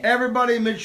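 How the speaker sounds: host announcement. Real, not acted. Host calling the comedian's name, emcee announcement. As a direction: hype the name to the room.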